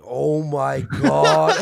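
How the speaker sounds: drawn-out, loud, stunned oh my God. Retold or imitated. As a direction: stretch it out in disbelief. Shocked reaction in retold DM.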